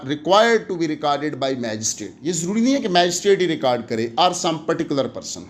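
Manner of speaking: ordinary talk, nothing marked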